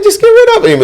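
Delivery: put-on high sassy girl voice. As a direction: squeak it like a girlfriend. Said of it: "I'm playing like a girl".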